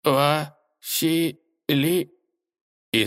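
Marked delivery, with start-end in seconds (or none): 0.0-1.4 slow syllable-by-syllable wind-up toy; mechanical voice. Acted. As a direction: speak like a winding-down toy. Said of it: "Механическим голосом она произнесла". (механическим голосом)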